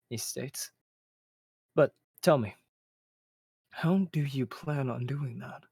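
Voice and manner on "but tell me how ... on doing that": slow, measured mentor voice; Xander voice. Acted. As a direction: ask it slowly, like a wise mentor.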